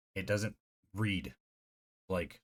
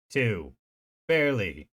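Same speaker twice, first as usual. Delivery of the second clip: blurting two words much louder and higher; mock sudden yell; 4 semitones higher, 11 dB louder than usual. Streamer mimicking the text-to-speech app shouting words at random, loud mock yell. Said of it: "It'll be like".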